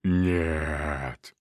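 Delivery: low, otherworldly demon refusal; demonic voice. Acted. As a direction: growl it like a demon. Possessed Misha refuses.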